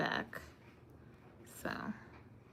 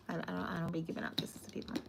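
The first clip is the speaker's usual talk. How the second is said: breathy whispered repeat, fast; 14 dB quieter, faster than usual. Acted. Turning down kisses from chat, in a whisper. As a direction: whisper it like a secret aside.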